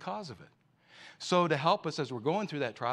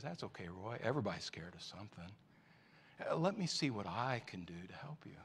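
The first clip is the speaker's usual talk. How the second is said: softer, lower, tender reassurance; gentle comfort; 4 semitones lower, 6 dB quieter than usual. Acted. Father kneeling beside his frightened son, soft and tender. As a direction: soothe a scared child.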